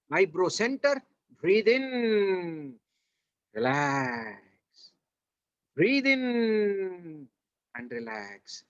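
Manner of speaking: drawn-out breathing cues, dropping low on "relax"; long, sustained meditation-teacher cues; guided relaxation. Shown as a demonstration. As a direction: lead a relaxation exercise, stretching each cue out long.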